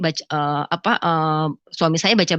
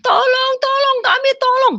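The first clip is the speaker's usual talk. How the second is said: very high wailing cry for help; teriak minta tolong; 4 dB louder than usual. Retold or imitated. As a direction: act out a crowd's desperate shrieks. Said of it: "semua manusia teriak-teriak minta tolong". (jeritan minta tolong bernada tinggi)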